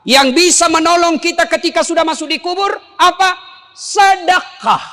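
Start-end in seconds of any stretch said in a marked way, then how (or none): 3.7-4.8 preacher drawing out the key word Sedekah; drawn-out shouted answer. Acted. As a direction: call out Sedekah slowly to the crowd. Answering own question to congregation.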